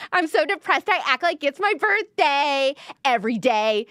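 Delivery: mock-happy voice covering sadness; fake cheerful. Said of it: "so fake like that".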